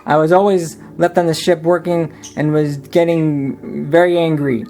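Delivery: first-person storyteller voice over music; story narration. A narrator's reading. Smee narrating his past to the listener, storytelling. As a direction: tell it like an old sailor's tale.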